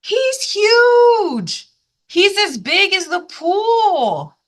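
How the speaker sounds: high, loud, slow astonishment; amazed. Real, not acted. Reader reacting to kids about the fish, amazed high exclaim. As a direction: gasp at how huge he is. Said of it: "reader reacts amazed".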